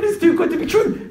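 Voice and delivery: high, loud, incredulous exclamation; amazed, voice pitched right up; shocked disbelief. Retold or imitated. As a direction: act out a man stunned by his luck. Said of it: "'Cause the man's shocked".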